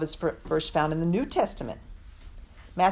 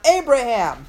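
calling out; 6 semitones higher, 9 dB louder than usual. Acted. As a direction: call the name out loud and high. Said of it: "The Lord calls out".

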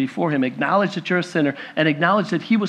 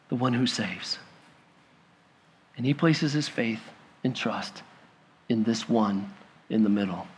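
low and much softer, reverent; hushed reverence; 5 semitones lower, 8 dB quieter than usual. Acted. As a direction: let it fall to a hush. Solemn climax about faith.

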